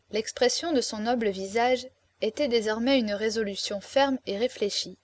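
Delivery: plain reading voice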